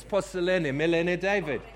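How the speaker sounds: hammed-up Greek accent for the crowd. Shown as a demonstration. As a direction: rattle off Greek words in a put-on accent. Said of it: "got to say the Greek words".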